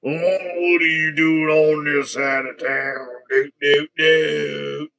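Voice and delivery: loud, slow mock southern voice; southern accent. Host voicing little Starscream, loud southern accent. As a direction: belt it out with a hammed-up southern accent. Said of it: "he's got a southern accent".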